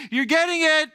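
high, loud "you've got it" call; exclaimed prompting. Acted. Mock prompting of slow listeners.

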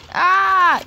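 loud high scream of alarm; a short high panicked scream; high frightened scream. A narrator's reading. Gordon screams as the boulder hits, high and loud. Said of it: "cried Gordon".

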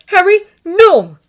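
high, loud and slow, pleading. A narrator's reading. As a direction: stop him with alarm in the voice. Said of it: "he said".

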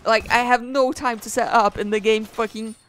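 angry rant. Real, not acted. Ranting at the game.

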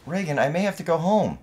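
loud, high-pitched protest; upset insistence. Acted. Worried son insisting to his partner, upset and loud. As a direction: push it hard at a resisting partner.

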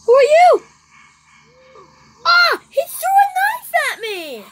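very high, loud shocked scream; startled scream. Real, not acted. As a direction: shriek in sudden fright. Attacked by knife thrower.

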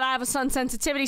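ordinary talk, nothing marked

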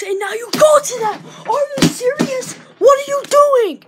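outraged yelling. Real, not acted. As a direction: yell in disbelief at the player. Kid outraged at football player.

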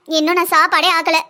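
usual speaking voice

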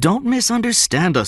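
high-pitched, quick reassurance; quick reply. A narrator's reading. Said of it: "Stone said quickly".

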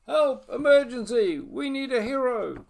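high loud distress call; calling for help. Acted. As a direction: shout for help from afar.

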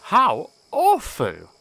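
slow, high sympathetic sigh. Acted. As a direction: feel for him. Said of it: "sympathized Edward".

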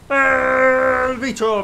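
"¡El bicho!" stretched very slow and loud; drawn-out celebratory shout. Acted. Streamer celebrating a great move to his viewers, slow stretched loud chant. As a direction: hold out "¡El bicho!" like a stadium chant.

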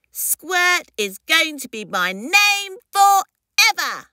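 high, defiant little creature voice; insistent hatchling. Acted. Baby insists on its name.

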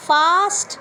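ফাস্ট with a clear f; teacher models ফাস্ট; f sound demo. Shown as a demonstration. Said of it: "প্রথমটা হচ্ছে ফা sound". (ফা দিয়ে ফাস্ট)

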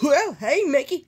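cartoon character answering a phone call; lightly voiced cartoon friend. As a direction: greet Mickey in a lightly put-on cartoon voice. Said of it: "I'm gonna call them on the speaker".

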